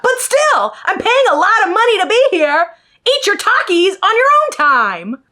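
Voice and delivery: high-pitched, worked-up complaint; indignant. Real, not acted. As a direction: protest indignantly, pitched well up. Indignant rant at the eating therapist.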